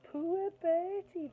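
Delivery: high, slow mimicking coo; mocking imitation. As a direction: copy her cooing to tease him. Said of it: "they mimicked".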